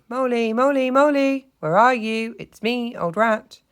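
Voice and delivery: high, loud sing-out; cheerful calling out. A narrator's reading. Rat searching the wood for mole, calling cheerfully. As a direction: call cheerily to a friend far off. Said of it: "calling out cheerfully".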